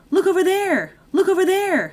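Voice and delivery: high, loud mock cry of alarm. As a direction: shout it high as a fake diversion. Acting Vizzini's diversion in recap.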